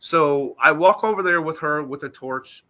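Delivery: ordinary talk, nothing marked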